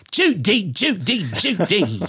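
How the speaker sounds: high, rising then falling Judy calls; Cary Grant impression explained to the guest; hacky Cary Grant. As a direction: toss off a corny Cary Grant. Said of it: "that really hacky Cary Grant impression".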